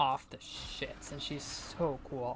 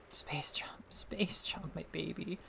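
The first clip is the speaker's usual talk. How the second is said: whispered, softer, to a new power-up; 2 semitones higher, 7 dB quieter, slower than usual. Real, not acted. Streamer whispering lovingly to her new Space Jump. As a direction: breathe it like a secret to a cherished pet.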